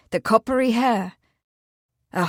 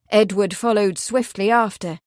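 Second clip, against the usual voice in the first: ordinary delivery, nothing marked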